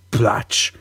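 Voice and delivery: whispered splash sound. Pretend.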